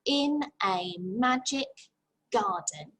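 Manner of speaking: very slow, one beat per word; tapping the syllables. Shown as a demonstration. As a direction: tap out each word slowly. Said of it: "On your shoulders".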